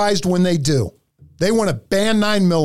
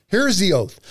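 normal talking voice

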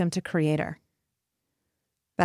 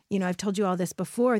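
normal talking voice

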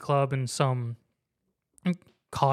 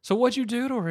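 lightly imitating joking banter, pitched up; higher, jokey voice of a pal; 9 semitones higher than usual. Friend asking the boyfriend on the phone, mock joking. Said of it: "they're just joking".

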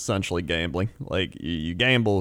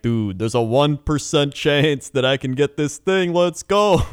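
building to a higher, louder cheer; hyped gamer; 6 semitones higher, 4 dB louder, slower than usual. Retold or imitated. Gamer hyping a one percent drop chance, building excitement. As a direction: pump yourself up for a long shot. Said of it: "where I'm like".

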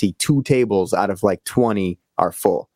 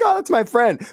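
mock sweet delight. Mimicking sweet friendly reaction.

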